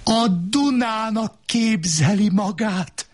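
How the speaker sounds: high, strained yell; shouted at a very high pitch. A narrator's reading. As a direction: yell it accusingly in a screaming match. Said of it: "Ordítoztunk".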